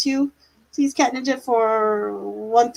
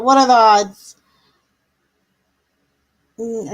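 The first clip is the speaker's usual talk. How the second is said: ordinary talk, nothing marked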